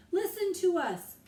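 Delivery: loud, high yell; loudest voice. A narrator's reading. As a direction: shout to the whole ship. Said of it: "Jack yelled in his loudest voice".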